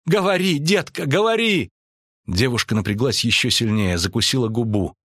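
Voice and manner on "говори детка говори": high, insistent commands cried out; urgent cry. A narrator's reading. Priestess urging the convulsing girl to speak, crying out. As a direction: urge her on in a high, pressing cry. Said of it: "кричала Нанеки".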